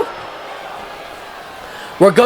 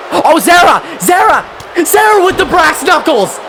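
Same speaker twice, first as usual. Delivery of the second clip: excited shouting; 7 semitones higher than usual. Real, not acted. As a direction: cheer the shock twist at full voice. Shocking brass knuckles moment.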